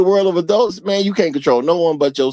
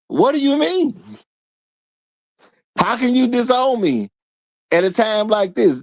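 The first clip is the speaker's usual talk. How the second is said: pleading daughter; 6 semitones higher than usual. Retold or imitated.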